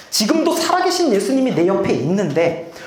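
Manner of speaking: ordinary talk, nothing marked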